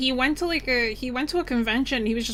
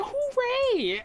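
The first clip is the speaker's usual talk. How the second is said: signature hooray. Shown as a demonstration. Streamer showing friends how she says hooray, squeaky high cheer. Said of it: "that's the only way that I say hooray".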